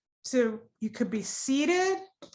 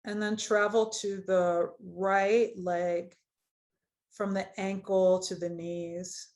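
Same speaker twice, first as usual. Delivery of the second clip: guided meditation. Acted. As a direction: ease them gently into the body scan. Leads a guided body scan.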